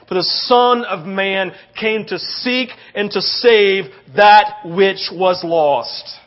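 loud, emphatic sermon delivery; forceful preacher, stretching key words; impassioned preaching. Real, not acted. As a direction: drive each phrase home to the congregation. Preaching builds to full force.

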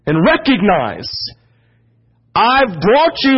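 high, ringing sermon delivery; impassioned preaching. Acted. Preacher relays God's rebuke to congregation, impassioned and high. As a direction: preach it with rising urgency and long stressed words.

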